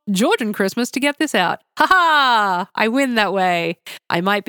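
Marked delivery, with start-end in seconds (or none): 1.8-2.7 loud gloating ha ha; triumphant laugh. Real, not acted. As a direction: crow a victorious laugh. Gloating about deadline loophole.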